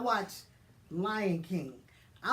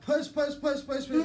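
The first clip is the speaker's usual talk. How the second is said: raised, urgent chant of push; louder and higher urging nurses; 4 semitones higher, 4 dB louder than usual. Retold or imitated. Nurses urging her to push, raised chant. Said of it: "So now they like".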